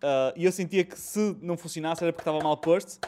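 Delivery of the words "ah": normal talking voice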